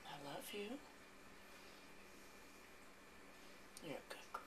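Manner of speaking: soft cooing pet talk, partly whispered. Real, not acted. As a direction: coo softly to a cat you love. Cooing to her cat.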